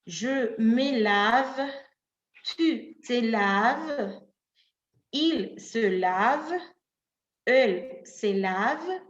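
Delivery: each form spoken slowly and clearly; slow conjugation drill. Shown as a demonstration. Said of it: "Entonces voy a decir". (conjugación lenta y clara)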